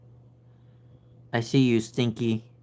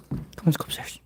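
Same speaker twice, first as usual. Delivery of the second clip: whispered coaxing; 2 semitones higher, 4 dB quieter than usual. Real, not acted. Whisper to cat.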